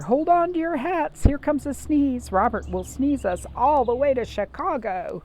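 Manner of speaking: high, loud excited warning; rally everyone to brace for it; doctor warning the party of a sneeze. Acted. Doctor warns of coming sneeze.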